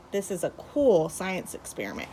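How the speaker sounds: ordinary talk, nothing marked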